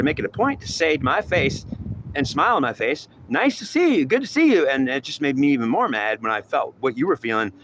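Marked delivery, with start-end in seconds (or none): 3.3-4.7 high, sugary repeated hello; singsong fake politeness; mocking fake greeting. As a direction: parody the phony welcome.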